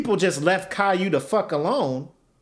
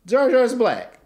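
laughing; 7 semitones higher, 3 dB louder, slower than usual. Retold or imitated. Laughing repeat of own quote.

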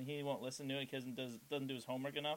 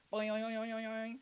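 high springy boing sounds; bouncy springy boingy voice; 8 semitones higher than usual. Acted. Springy doorstop again.

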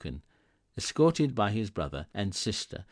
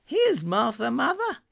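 a lightly voiced girl, much higher, rising at the end; young girl voice; 8 dB louder than usual. A narrator's reading. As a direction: announce your own arrival brightly as a girl. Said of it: "said a girl".

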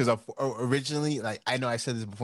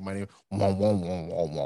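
muffled wah wah talk; 4 semitones lower than usual. Retold or imitated. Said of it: "that Charlie Brown treatment".

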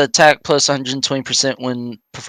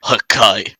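Beerus Hakai; 3 semitones lower than usual. Retold or imitated. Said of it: "you gotta lower your voice".

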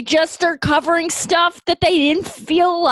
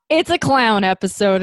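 ordinary talk, nothing marked

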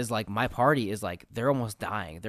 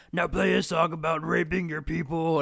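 higher-pitched, eager brute; gleeful Fomorian boss; 6 semitones higher than usual. Retold or imitated. Fomorian captor giving his bard an order, high and gleeful. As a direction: put on the monster captor making a demand. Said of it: "then the big Fomorian goes".

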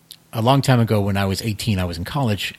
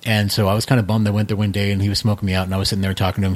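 ordinary talk, nothing marked

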